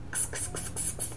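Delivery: soft voiceless "ks" kisses; kissing noises. A narrator's reading. Ice-cream man gives the X flavour, kissing noises. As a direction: make a string of little smacking hisses.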